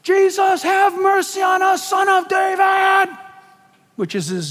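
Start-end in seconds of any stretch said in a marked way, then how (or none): 0.0-3.2 high, drawn-out hammed-up cry for mercy; yelling. Blind men yelling to Jesus over the crowd, yelling. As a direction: yell it out, stretching the last name. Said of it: "they're just yelling all the more".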